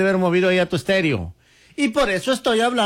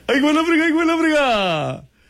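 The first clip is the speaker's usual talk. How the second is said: excited exclamation; 8 semitones higher than usual. Real, not acted. Host reacts in disbelief.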